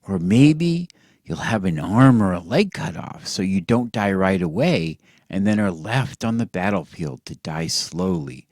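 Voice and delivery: ordinary delivery, nothing marked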